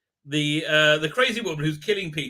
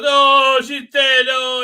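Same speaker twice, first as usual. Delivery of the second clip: high, loud hammed-up grief; mock distraught wail; 8 semitones higher, 11 dB louder, much slower than usual. Vlogger mocking the heroine's overacted grief, loud mock wail. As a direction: overplay the shock of finding her dead. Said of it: "and she goes".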